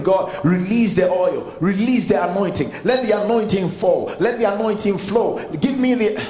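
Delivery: mimicking fervent prayer. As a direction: ham up the begging prayers. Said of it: "So people are praying".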